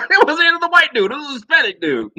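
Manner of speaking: laughing, high-pitched incredulity; amused disbelief. Real, not acted. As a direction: crack up while landing the punchline. Laughing at his own punchline.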